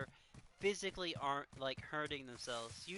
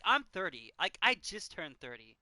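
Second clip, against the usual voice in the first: ordinary delivery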